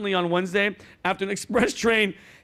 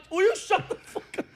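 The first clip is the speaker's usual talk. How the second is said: strained plea; 12 semitones higher than usual. Retold or imitated. Crushed under bar, begging.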